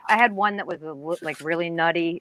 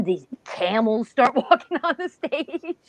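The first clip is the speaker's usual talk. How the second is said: higher pitch breaking into a laugh; amused amazement; 4 semitones higher, slower than usual. Real, not acted. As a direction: tell the astonishing bit with a real laugh. Amazed telling of live camels.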